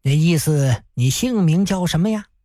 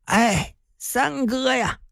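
bleak, sad smile; 5 semitones higher, slower than usual. A narrator's reading. Ailing Hu greets his old senior brother, bleak and forlorn. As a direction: sigh it out like a broken old man. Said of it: "胡振业凄凉的一笑".

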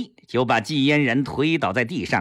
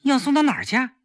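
higher, startled sudden question; a jolt of surprise in a quick question; 11 semitones higher, much faster than usual. Acted. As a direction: ask it caught off guard. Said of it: "季嫣然一愣，忙问".

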